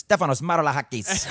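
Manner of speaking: put-on name in a hammy Greek accent. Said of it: "really valid Greek accent".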